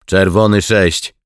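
loud, high radio call. A narrator's reading. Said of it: "próbował wywoływać Bagiński". (głośne, wysokie wywoływanie przez radio)

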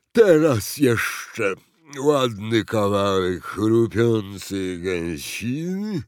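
slow, ponderous character voice; fat, gluttonous president. A narrator's reading. President orders goose by pill, ponderous and greedy. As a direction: savour each word heavily.

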